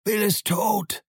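high, drawn-out call from afar; slow, high holler; bellowed report. A narrator's reading. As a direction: cry out the news from inside a house. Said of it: "dann brüllte eine Stimme aus dem Haus heraus".

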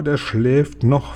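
usual speaking voice

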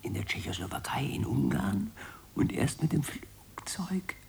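hushed conspiratorial whisper, unvoiced. Official whispers travel plans to colleague, secretive whisper. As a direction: breathe "Tschechoslowakei" as a secret whisper.

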